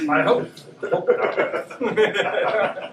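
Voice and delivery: broken up by laughter, drawn out; laughing. Real, not acted. Player answers game master's grim guess that everybody was killed, laughing.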